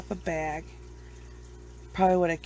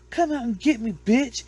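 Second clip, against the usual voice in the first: raised, higher taunt; mock aggressive challenge; 5 semitones higher, 4 dB louder than usual. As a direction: imitate a ghost hunter daring demons. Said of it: "he tries to fight the ghosts".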